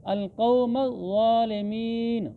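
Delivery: ज़ालिमीन with a firm double z and long aa; slow recitation, ज़ालिमीन held long to a closed n; doubled, elongated sound. Shown as a demonstration. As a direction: lean into the z of ज़ालिमीन, then stretch it. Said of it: "ज़ा पर शद भी है और खड़ी ज़बर भी है".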